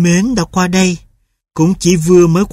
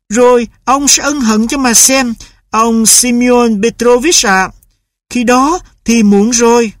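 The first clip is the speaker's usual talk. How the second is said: higher, icy formal threat; mother's cold warning; 8 semitones higher, 3 dB louder than usual. A narrator's reading. Mother warns husband he'll regret it, higher and cold. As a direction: warn him formally.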